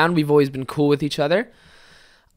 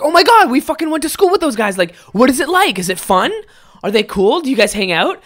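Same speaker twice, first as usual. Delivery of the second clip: high, eager questions, a touch louder; voiced friends asking eagerly; excited gossip; 8 semitones higher, 4 dB louder than usual. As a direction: gush like an old schoolmate hearing news.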